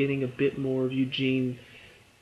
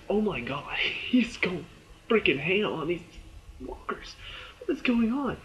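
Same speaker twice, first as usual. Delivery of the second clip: high excited squeal with a laugh; amazed outburst; 5 semitones higher than usual. Retold or imitated. Fan reviewer reliving the fight, high thrilled exclaim. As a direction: gasp at the screen in awe. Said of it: "I'm just like".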